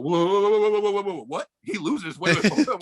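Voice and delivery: high, rapid-fire mock panic; alarmed objection. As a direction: imitate a flustered manager halting everything. Acting out manager's alarmed objection.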